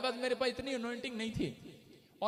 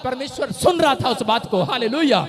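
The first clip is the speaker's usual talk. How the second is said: booming hallelujah; loud proclamation; 13 dB louder than usual. Real, not acted. Preacher proclaiming God heard, loud. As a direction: lift the voice to the church.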